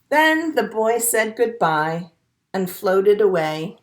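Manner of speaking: gentle storybook read-aloud; picture-book narration for a class; reading to children. A narrator's reading.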